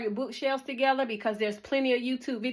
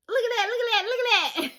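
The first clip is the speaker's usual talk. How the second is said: much higher, laughing with delight; squealing at her own work, giddy and proud; excited; 11 semitones higher, faster than usual. Real, not acted. Vlogger shows viewers her finished closet shelves, giddy excitement.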